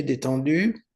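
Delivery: ordinary talk, nothing marked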